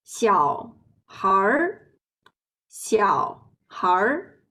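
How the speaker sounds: 小孩儿 said slowly with a curled er ending; 小孩儿, slow, with the er sound added at the end; erhua demo. Shown as a demonstration. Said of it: "we pronounce it like".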